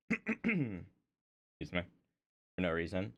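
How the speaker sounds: ordinary talk, nothing marked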